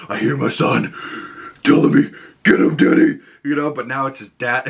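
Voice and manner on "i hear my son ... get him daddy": imitating the Punisher. Reviewer quoting Frank hearing his son, intense imitation. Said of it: "he goes".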